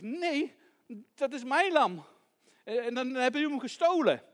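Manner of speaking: put-on high, loud accusing child; indignant protest. Child argues back with thief.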